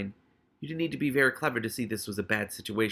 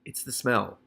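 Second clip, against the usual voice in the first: usual speaking voice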